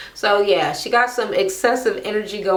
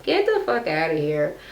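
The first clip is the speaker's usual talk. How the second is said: normal talking voice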